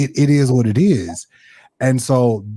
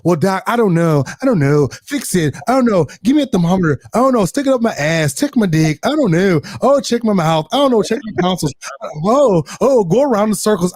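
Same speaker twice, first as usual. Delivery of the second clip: mock clueless patient; 5 semitones higher than usual. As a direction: play the patient who can't say what hurts.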